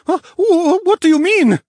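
very high, loud stammering alarm; panicking bear cub, voice shooting up. A narrator's reading. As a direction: stammer it out in a high, frightened cry. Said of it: "the bear's voice raised in pitch".

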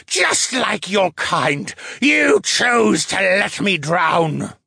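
high, slow, breathy spirit wail; breathy wailing scream. A narrator's reading. Spirit accuses her kind, breathy wailing scream. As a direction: wail it out slowly with lots of air. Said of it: "wailed in a breathy scream".

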